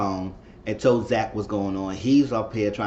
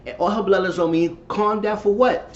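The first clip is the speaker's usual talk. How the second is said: much higher, indignant voice in mock disbelief; indignant outburst; 10 semitones higher than usual. Telling Pam off for saying calm down, high indignant voice. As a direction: throw it back at her, outraged and high. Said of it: "I said".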